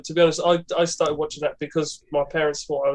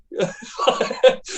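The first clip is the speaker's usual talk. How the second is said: sudden high laugh; high burst of laughter. Real, not acted. Guest laughing at his own cave answer.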